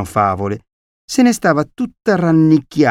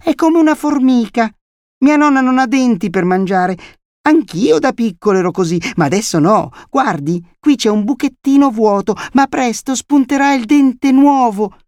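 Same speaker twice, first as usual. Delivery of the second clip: put-on high, loud child's voice; prattling little boy; 12 semitones higher, 4 dB louder than usual. A narrator's reading. Boy showing visitor his tooth gap, high childish prattle. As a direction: chatter on eagerly as a small boy.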